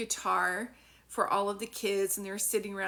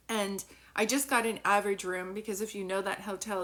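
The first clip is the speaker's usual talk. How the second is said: usual speaking voice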